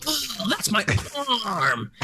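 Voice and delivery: high-pitched strained mock cry; imitating Gilbert. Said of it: "She makes Gilbert go".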